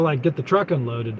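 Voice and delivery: normal talking voice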